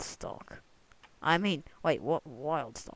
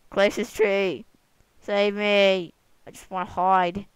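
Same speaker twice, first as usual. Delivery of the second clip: mock panic; 4 semitones higher, 12 dB louder than usual. Panicking bird seeks cover.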